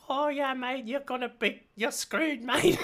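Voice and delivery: high chirpy New Zealand sidekick voice; little sword friend voice. Retold or imitated. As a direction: squeak it out like a tiny Kiwi sidekick. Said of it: "He's from New Zealand".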